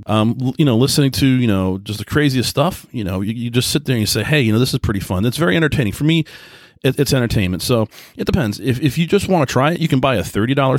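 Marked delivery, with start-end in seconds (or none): none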